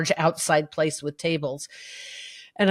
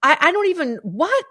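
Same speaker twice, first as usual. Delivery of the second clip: indignant; 9 semitones higher, 4 dB louder than usual. Retold or imitated. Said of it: "I'm talking aloud indignantly".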